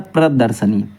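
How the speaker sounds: low-pitched प्रदर्शनी with a before the n; correct pronunciation. Shown as a demonstration. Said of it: "प्रदर्शनी होता है".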